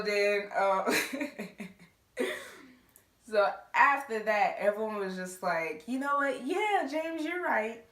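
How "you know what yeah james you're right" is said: high, sing-song fake agreement; mock agreement. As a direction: pretend to believe him, sarcastically. Everyone pretending to believe James.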